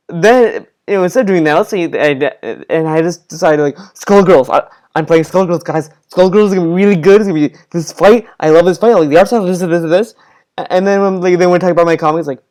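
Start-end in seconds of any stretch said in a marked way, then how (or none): 3.6-10.1 gushing in quick, louder bursts; mock fanboy rush, tripping over the words; hyped-up gamer. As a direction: imitate excited raving about a game. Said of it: "He sounds really excited when he's talking about video games".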